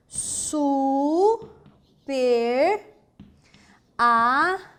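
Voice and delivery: "Super amigo" as su, per, a, mi, go, drawn out with long gaps; syllable by syllable. Shown as a demonstration. Teacher trying out a possible split, slow separate syllables. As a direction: dictate "Super amigo" slowly, syllables rising. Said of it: "Como você separaria super amigo?".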